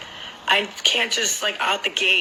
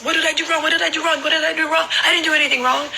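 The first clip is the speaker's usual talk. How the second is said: high, repetitive mock ranting; retold indignant outburst, short of full yelling; imitated angry blow-up; 7 semitones higher, faster than usual. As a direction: fire off the same protest in a raised pitch. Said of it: "he just blew up".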